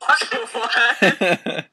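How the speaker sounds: high burst of laughter. Real, not acted. Cracking up at the bad fanfic, a high laugh.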